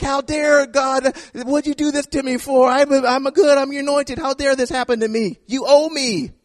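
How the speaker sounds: high, indignant and entitled; mock outraged entitlement; indignant demand. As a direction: demand what you think you're owed. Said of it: "David was like".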